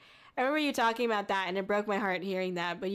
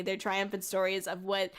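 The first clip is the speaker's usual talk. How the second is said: ordinary talk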